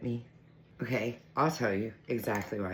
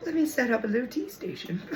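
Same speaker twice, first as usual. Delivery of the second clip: higher, cutesy self-quote; quoting herself; 11 semitones higher than usual. Retold or imitated. She quotes herself deciding on a tea station, cutesy. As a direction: say it bright and cute. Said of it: "I was like".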